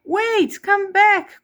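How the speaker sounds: put-on the prince calling after a fleeing girl. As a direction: call after her across the steps. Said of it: "called the prince".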